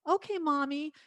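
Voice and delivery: put-on high-pitched obedient child; little girl. Little girl answering her mother's request, obedient child voice. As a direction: play yourself as a small child, voice lifted high. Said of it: "when I was a very little girl".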